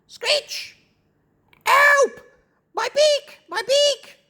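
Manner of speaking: very high parrot squawks; acted high bird cries of pain; distressed parrot. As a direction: squawk for help like a hurt parrot. Hurt parrot cries for help.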